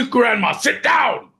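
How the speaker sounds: loud high shout. Pretend. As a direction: yell it at grandma. Yelling at grandma in bit.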